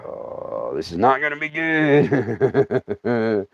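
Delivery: slow, quiet dread; drawn out under the breath; slow hushed dread. Retold or imitated. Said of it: "It's like".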